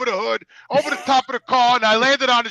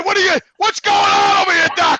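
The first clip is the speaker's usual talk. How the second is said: mock alarmed yell; 7 semitones higher, 4 dB louder than usual. Protesting to the doctor.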